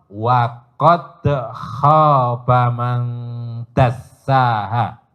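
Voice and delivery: slow chanted recitation of the verse; slow melodic Quranic chant. Real, not acted. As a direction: intone it slowly like Quran reading.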